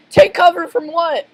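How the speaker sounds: high, loud, bewildered cry; frantic demand; panicked question. Acted. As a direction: shout it in confused alarm. Plankton running in panic.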